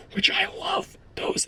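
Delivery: a breathy whisper slipped in as an aside. Real, not acted. Whispering to viewers about the goggle design. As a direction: lean in and whisper it like a secret.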